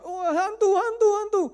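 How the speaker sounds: high, loud panicked shrieks of ghost; panicked shrieking. Retold or imitated. Disciples shouting at each other in the storm, panicked shrieks. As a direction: shriek it like scared fishermen. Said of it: "Ini kan berteriak-teriak ya".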